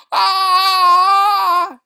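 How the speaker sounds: very high, loud long scream. Acted. Tormented sleeper screaming, long scream. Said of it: "he screamed".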